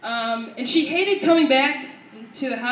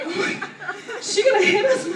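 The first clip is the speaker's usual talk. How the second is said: higher-pitched pretend panic; mock frightened kid; 7 semitones higher than usual. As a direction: play a kid scared of mom. Frightened child reacting to mom's yelling.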